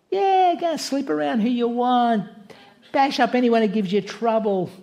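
a hint of a sly devil; mock devilish voice. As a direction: toss it off like a careless tempter. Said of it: "if Satan was God".

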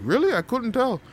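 loud, very high mock surprise; imitating an astonished instructor, squeaky high; surprised instructor. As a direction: react in a put-on falsetto, amazed. Instructor surprised at his nerves.

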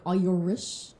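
"Irish" stretched into "Oy-yer-rish"; exaggerated Irish pronunciation. Shown as a demonstration. Spelling it out for a friend, "Irish" as "Oy-yer-rish". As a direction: stretch "Irish" out, rounded "oy" first.

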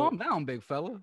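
high, loud teasing calm-down; teasing impression of a partner calming a big man; mocking his partner. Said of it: "did I call it big fella".

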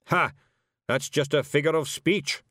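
snapped. Acted. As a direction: snap back dismissively. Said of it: "snapped Zack Zack".

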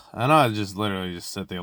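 ordinary delivery

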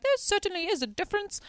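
put-on high, fast, prim old-lady correction; huffy bird lover setting them straight, pitched high; old busybody cutting into the phone call, high and prim. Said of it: "this old busybody comes up".

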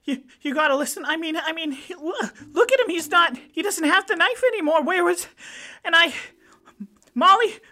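Stacy pleading with Molly; panicked pleading. Acted. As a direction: babble it out, rising to a cry.

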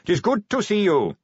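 high, hearty welcome; effusive greeting. A narrator's reading. King welcomes Vimes like a long-lost son, effusively. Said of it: "greeting Vimes like a long-lost son".

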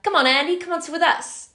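put-on coaxing older friends. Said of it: "they were like".

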